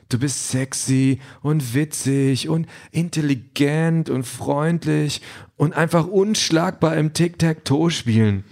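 fawning AI companion, lilting high; digital boyfriend. A narrator's reading. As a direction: pile on compliments as a simulated boyfriend, drawn out and high. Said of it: "sagt Denise persönlicher digitaler Freund".

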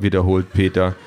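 ordinary delivery

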